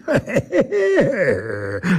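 long, high cackling laugh; mocking creature laugh. A narrator's reading. Creature laughs at the narrator's threat, mocking cackle. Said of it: "Smál se ten chlap".

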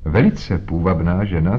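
plain reading voice

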